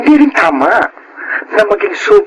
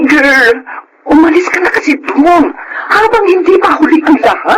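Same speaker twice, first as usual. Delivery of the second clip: urgent urging; 10 semitones higher than usual. Acted. Friend urgently urging her to leave.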